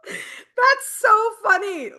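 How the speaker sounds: slow, laughing, half crying. Real, not acted. Laughing at the repeat choice.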